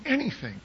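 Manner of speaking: "anything" repeated very high, as if underlined; mock-underline "anything" with a jump in pitch; stress on anything. Shown as a demonstration. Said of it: "we're circling this and underlining it".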